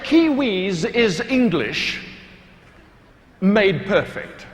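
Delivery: slow, each word set down; slow deliberate retort. Retold or imitated. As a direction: land the punchline slowly and proudly. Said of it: "I said".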